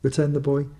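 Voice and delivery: plain reading voice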